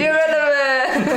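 slow, drawn-out hyped shout; excited. Acted. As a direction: call out the title like an announcer. Shouting show name again.

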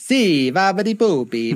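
mock Italian gibberish. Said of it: "Italienisch zu sprechen mit, äh, babidi bubidi".

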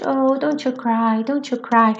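tender, drawn-out comforting; maternal soothing. Acted. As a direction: coo it slowly to calm a little one. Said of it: "como quem tá querendo acalmar uma criança".

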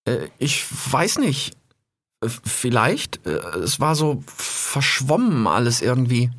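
stammering and dazed on waking; groggy. Acted. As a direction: stammer like just woken from poison. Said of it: "immer noch ganz duselig".

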